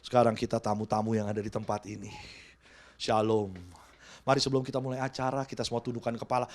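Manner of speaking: imitating an emcee at a funeral, solemn and hushed; mock funeral emcee. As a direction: intone like a funeral MC. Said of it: "jadi ada W L-nya gitu".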